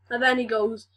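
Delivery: normal talking voice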